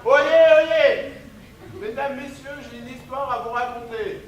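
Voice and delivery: booming proclamation to the crowd; town crier. Acted. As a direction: call it out like a herald. Calls out to assemble audience.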